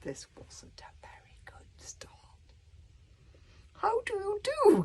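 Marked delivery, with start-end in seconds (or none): 3.7-4.8 high, polite little gulp; gulped politely. A narrator's reading. Boy greets the angry warlord, high nervous politeness. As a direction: squeak it out nervously. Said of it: "gulped Hiccup politely".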